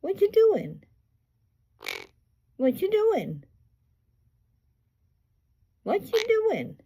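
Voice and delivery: high singsong parrot question; imitating parrot talk. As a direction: ask it high, like a talking bird. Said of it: "And he says".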